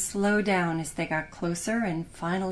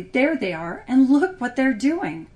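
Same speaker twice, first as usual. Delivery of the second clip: sharp; 5 semitones higher, 6 dB louder than usual. Acted. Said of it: "His wife said sharply".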